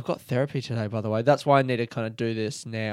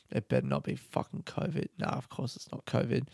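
muttered; 2 semitones lower, 11 dB quieter than usual. Real, not acted. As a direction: mutter under your breath. Muttering about covid worry.

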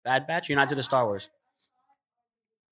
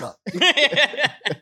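hammed-up big brother shutting down his sibling; big brother snap; 9 semitones higher than usual. Big brother brushing off his little brother, mock snapping. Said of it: "He's like".

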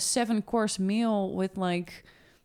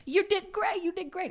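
cheering sideline parent; 14 semitones higher than usual. Retold or imitated. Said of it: "parents at the sideline".

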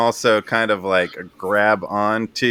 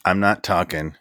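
low, flat defiance; stubborn low refusal; 5 semitones lower, 3 dB quieter than usual. Acted.

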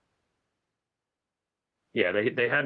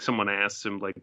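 normal talking voice

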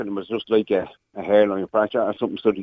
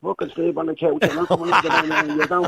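mock angry wife; 8 semitones higher than usual. Retold wife's angry outburst in taxi.

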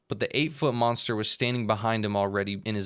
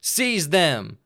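louder, higher order; command shout; 6 semitones higher, 6 dB louder than usual. Acted. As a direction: bark the order. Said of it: "Bug Santa called out".

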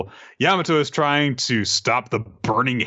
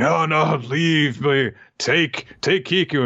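mock noble plea; 5 semitones higher, slower than usual. Voicing noble dying samurai.

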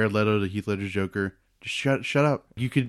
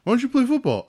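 mocking high voice; faster than usual. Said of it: "retold question".

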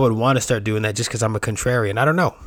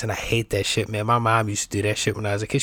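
usual speaking voice

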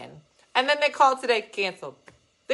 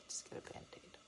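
whispering; 23 dB quieter than usual. Pretend. Whispered nurse reply.

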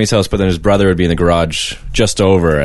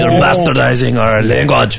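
mock French accent; 4 semitones higher, slower than usual. Voicing offended French.

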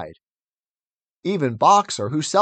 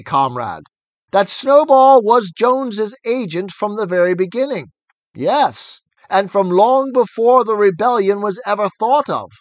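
firm; 4 semitones higher, 6 dB louder than usual. A narrator's reading. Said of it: "speaking very slowly and firmly".

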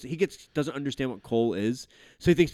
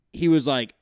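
ordinary talk

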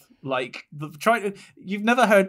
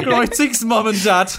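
higher, laughing Brummie greetings; Brummie accent; 6 semitones higher, 3 dB louder than usual. Retold or imitated. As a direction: say it the Birmingham way. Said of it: "that is spelled G R O I T I N G S".

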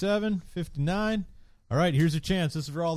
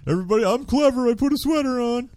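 mocking smug voice; 11 semitones higher, 4 dB louder than usual. Mocking smug sweater wearers.

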